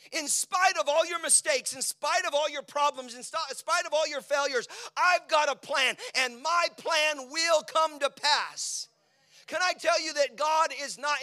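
loud, high preaching, slowing to a crawl; full-force preaching. Acted. Pastor preaching to the congregation at full force. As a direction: preach it out with power.

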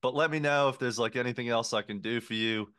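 put-on Aussie waiter being helpful; fake Australian accent. Waiter offering help in a fake Australian accent. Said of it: "definitely a fake Australian accent".

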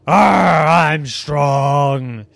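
loud growl, then I'm strong. Acted. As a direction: growl it out like a beast. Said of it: "Now you, Tex".

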